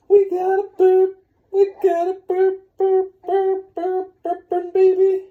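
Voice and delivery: voce acutissima e forte, festeggia il ruttino. Real, not acted. Cheering baby's burp.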